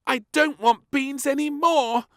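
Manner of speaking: put-on high, loud little-girl bellow; bellowing princess. A narrator's reading. Princess bellows at king she hates beans. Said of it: "she bellowed".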